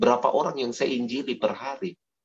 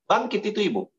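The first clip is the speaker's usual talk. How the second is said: commanding rise; 8 semitones higher, faster than usual. Acted. Acted command in the story.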